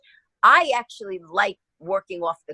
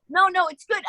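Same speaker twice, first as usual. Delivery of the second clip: mock crew voice; 10 semitones higher than usual.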